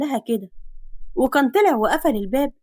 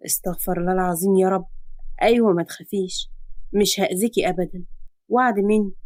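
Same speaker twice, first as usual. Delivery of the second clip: exasperated hero; 5 semitones lower than usual. Acted. Man composing himself, promising girl. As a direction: lower man's voice, sighing.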